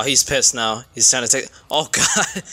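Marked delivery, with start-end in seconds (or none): none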